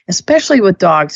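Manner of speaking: ordinary talk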